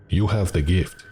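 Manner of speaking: put-on thick Russian-accented voice. A narrator's reading. Russian man tells narrator of a gift, Russian accent. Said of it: "his Russian accent thick".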